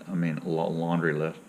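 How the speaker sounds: ordinary delivery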